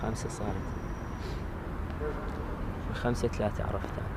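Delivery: normal talking voice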